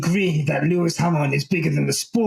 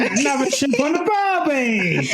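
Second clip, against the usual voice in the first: mock Australian; 9 semitones higher than usual. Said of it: "doing a British accent or Australian accent".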